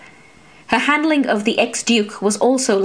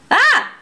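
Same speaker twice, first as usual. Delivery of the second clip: high, loud startled yelp; sudden yelp as roots grab her foot; sharp cry of pain; 4 dB louder than usual. A narrator's reading. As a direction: cry out as she is yanked down. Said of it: "Laéron cried out".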